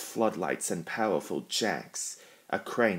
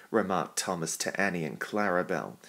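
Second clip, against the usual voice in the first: ordinary delivery, nothing marked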